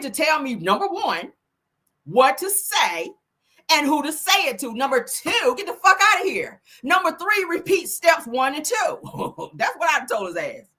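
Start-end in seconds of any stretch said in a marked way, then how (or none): 2.0-9.5 slow, higher, each rule pressed; defiant list, last step slow; emphatic point by point. Real, not acted. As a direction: lay down the law step by step. Counting off rules to rival.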